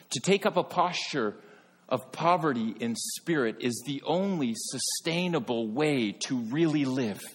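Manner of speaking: fervent preaching. Acted. Pastor urging his church, preaching at full force. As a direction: build the sermon to a ringing climax.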